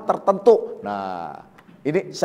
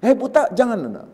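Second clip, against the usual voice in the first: sneering jeer; 6 semitones higher than usual. Retold or imitated. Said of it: "ya nyinyir".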